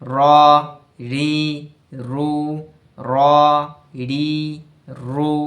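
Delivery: র রি রু said short and firm with the breath held; clipped voiced syllables র, রি, রু; letter drill. Shown as a demonstration. Teacher drilling র vowels, firm voiced. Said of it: "বাতাস কি? বন্ধ।".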